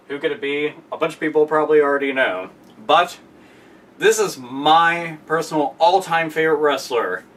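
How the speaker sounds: ordinary delivery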